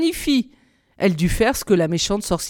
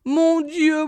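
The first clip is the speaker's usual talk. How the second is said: moaning lament; 7 semitones higher than usual. A narrator's reading. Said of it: "gémissait-elle".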